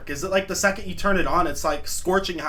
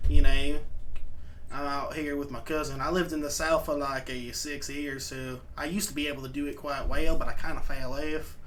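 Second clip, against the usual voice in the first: southern accent; 4 semitones lower than usual. Shown as a demonstration. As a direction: show off a half-remembered southern accent, low and easy. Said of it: "we got the southern accent, it's kinda like this".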